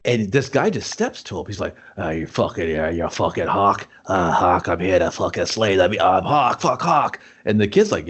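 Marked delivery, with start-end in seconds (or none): 2.0-7.2 lightly voiced low-pitched challenger; mock a thick-headed rival calling someone out; belligerent challenger. Acting out challenger confronting Hawk.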